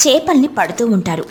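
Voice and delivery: ordinary delivery, nothing marked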